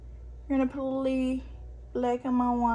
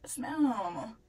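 ordinary talk, nothing marked

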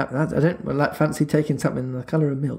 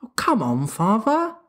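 put-on young son; 9 semitones higher than usual. A narrator's reading. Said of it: "said John".

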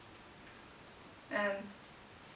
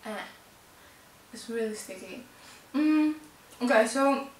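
chewing while talking; talking around sticky candy; mouth partly full. Real, not acted. Seen 1: hand at mouth, jaw working on candy while talking.

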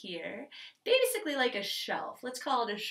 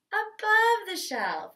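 slow, slightly raised voice; above the shelf; 8 semitones higher, 4 dB louder, slower than usual. Shown as a demonstration. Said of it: "you can already hear the change in my pitch".

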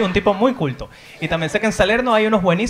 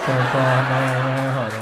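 slow teasing aside; 4 semitones lower, much slower than usual. Pretend. Repeated comic aside.

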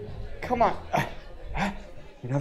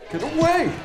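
raised-pitch play alarm; mock panicked cry; 8 semitones higher than usual. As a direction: fend off an attacker in a fluster. Fending off attacker in game.

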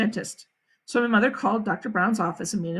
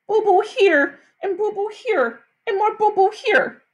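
put-on high toddler voice; little kid showing boo-boos; 9 semitones higher, 3 dB louder than usual. A narrator's reading. Little brother showing his wounds, high childish voice. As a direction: point out each hurt like a small child.

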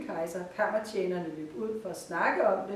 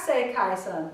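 ordinary delivery, nothing marked

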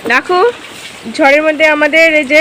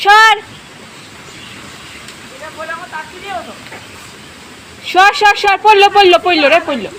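urgent shouting; 5 semitones higher than usual. Real, not acted. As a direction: yell at him to get clear. Warning brother of falling branch.

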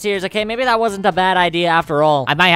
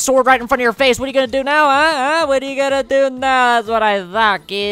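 higher, cocky taunting; mock the cornered enemy; 5 semitones higher than usual. Taunting opponent face to face.

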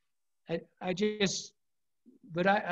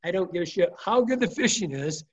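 ordinary talk

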